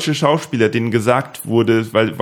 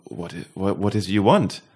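imitating puzzled English passer-by; 4 semitones lower, 5 dB quieter than usual. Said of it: "Und die Person hat gesagt".

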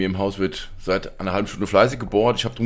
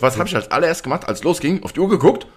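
excited; 8 semitones higher, 4 dB louder than usual. Retold or imitated. Retelling own reaction, rising excitement.

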